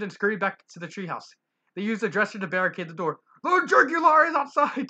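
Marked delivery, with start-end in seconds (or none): 3.4-4.9 high, loud panicked cry; scared scream. Acted. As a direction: scream it in terror. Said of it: "Tigger screamed".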